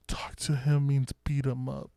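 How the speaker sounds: hushed aside from behind someone; retold girlfriend's conspiratorial whisper; imitated whisper. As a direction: whisper it like a secret translation. Girlfriend whispers from behind the drunk man.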